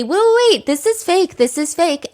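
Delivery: a sleuth who just spotted the fake, pitch way up; excited internet sleuth. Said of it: "Some internet sleuth is gonna say".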